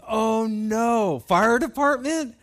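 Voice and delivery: high, loud, slow rising panic; panicked thought. Retold or imitated. Thinking the sister will be furious, high loud panic. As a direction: dread the fire department coming. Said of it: "And I'm thinking".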